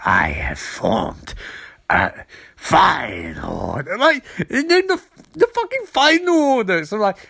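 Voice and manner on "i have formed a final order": much lower, slow mock sinister emperor; Palpatine voice. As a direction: intone it low and slow like a scheming villain. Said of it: "but in this movie he's like".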